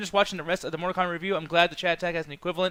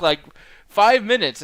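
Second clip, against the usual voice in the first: normal talking voice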